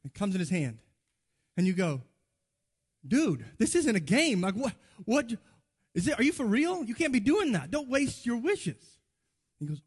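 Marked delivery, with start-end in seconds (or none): 3.0-9.0 high, incredulous acted scolding; exasperated disbelief. You scold your friend for wasting wishes, acted exasperated disbelief. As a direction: protest with swooping, raised pitch.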